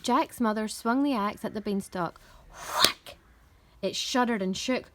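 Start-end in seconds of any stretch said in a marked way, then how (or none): 2.5-3.2 breathed, unpitched chop sound; axe whack. A narrator's reading. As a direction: make the word an axe blow. Imitating the axe blow.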